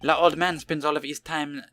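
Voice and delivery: slow, hammed-up French accent with French words; mock French accent. French chef telling detectives where the old man is, in a hammed-up French accent.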